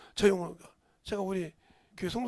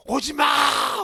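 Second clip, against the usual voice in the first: put-on loud, higher cry of an old pastor; retold crying shout; 5 semitones higher, 11 dB louder, much slower than usual. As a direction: shout it out, pleading. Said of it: "우시더라고". (울먹이며 외치는 소리)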